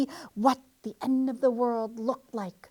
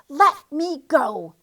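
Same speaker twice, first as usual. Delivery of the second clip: slow, loud, higher shout; 4 semitones higher, 8 dB louder, slower than usual. A narrator's reading. Trapped guinea pig shouting loud enough to wake the farmer. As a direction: bellow it out to wake the house. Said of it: "shouted Quee".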